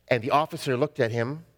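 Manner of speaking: normal talking voice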